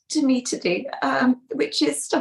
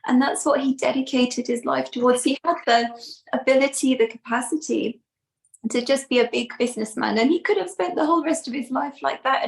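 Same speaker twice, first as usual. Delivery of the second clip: ordinary talk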